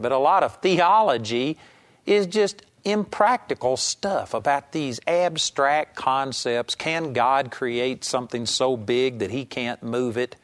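ordinary talk

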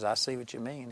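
soft, subdued giving-in; guard dropped, hushed acceptance; retold tearful admission. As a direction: quietly imitate a man conceding through tears, understated. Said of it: "he began to cry".

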